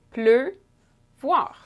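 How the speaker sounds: "Pleuvoir" split with a gap before "voir"; syllable breakdown. Shown as a demonstration. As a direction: hold the closed eu in "Pleuvoir", then say "voir" separately. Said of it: "it's at the end of a syllable".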